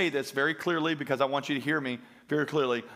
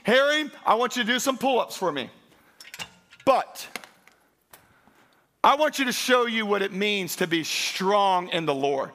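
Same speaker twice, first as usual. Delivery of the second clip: loud, high, driving sermon; full-force preaching; 10 semitones higher, 7 dB louder than usual. Acted. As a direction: preach it hard to the congregation.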